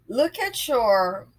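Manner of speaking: ordinary talk, nothing marked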